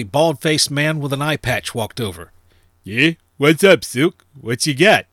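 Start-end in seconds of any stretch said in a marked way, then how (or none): 2.8-5.1 louder, higher voice for a portly crony; curt greeting from a wary underworld contact; Paulie. A narrator's reading. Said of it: "eyeballing Nix warily the whole time".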